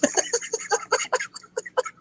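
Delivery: high, airy giggling; can't stop giggling; helpless giggle. Real, not acted. To his viewers after the ninja joke, a helpless giggle. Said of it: "that one tickled me".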